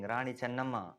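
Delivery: usual speaking voice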